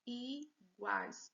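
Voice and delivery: iguais split i, guais with a gap; syllable by syllable. Shown as a demonstration. Teacher showing two syllables, iguais broken apart. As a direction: count out two parts of iguais. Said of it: "Duas sílabas".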